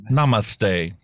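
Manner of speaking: ordinary talk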